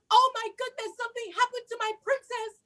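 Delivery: high, fast, panicked gasp; alarmed mother. Acted. Mother finds the door open, high and frightened. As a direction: rush it out in alarm.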